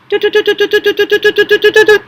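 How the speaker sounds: rapid string of short high toots; teruah trumpet blasts. Shown as a demonstration. Teacher demonstrating the travel signal, staccato trumpet toots. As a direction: stutter out quick horn pips on one note. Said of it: "then the teruah".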